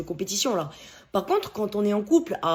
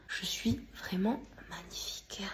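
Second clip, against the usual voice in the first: whisper; 14 dB quieter than usual. Acted. Admires herself in mirror.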